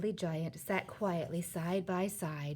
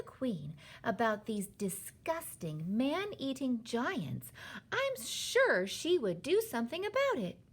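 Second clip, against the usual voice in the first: higher-pitched, eager plan; excited; 6 semitones higher, slower than usual. A narrator's reading. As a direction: lay out a brilliant idea, stretching key words. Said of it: "in a voice filled with excitement".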